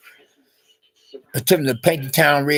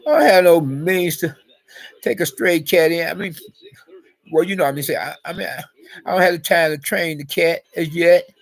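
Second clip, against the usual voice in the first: really emotional; 6 semitones higher than usual. Real, not acted. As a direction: get worked up and plead your excuse in a raised pitch. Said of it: "I'm getting really emotional".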